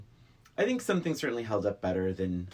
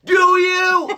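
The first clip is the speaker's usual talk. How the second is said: mocking squeal, pitch jumps way up and loud; 16 dB louder than usual. Mocking Bender's question to friends with a squeaky yelp.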